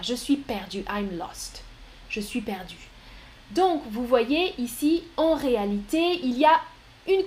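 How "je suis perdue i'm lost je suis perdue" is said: soft, low, fading words; quiet defeated murmur; quiet and lost. Shown as a demonstration. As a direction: let the voice sink, lost. Acted lost speaker.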